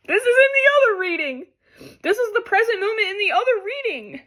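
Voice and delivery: high, teary voice; real tears breaking through; weeping disbelief; crying. Real, not acted. Crying over the repeated card.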